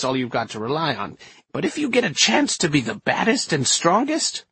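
put-on high, swooping feisty old-lady voice; feisty old woman, scornful. Inez dismisses evasive techniques, feisty and scornful.

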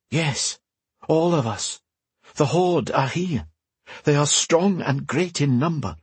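an urgent voice from afar, kept gentle; mysterious woman voice. Acted. Telepathic woman speaking.

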